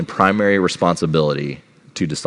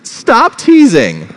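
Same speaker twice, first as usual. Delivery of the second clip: put-on high, loud, drawn-out child's wail; hammed-up tearful whine; mock crying; 13 semitones higher, 8 dB louder, much slower than usual. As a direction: imitate an upset little girl pleading. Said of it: "starts crying. She's like".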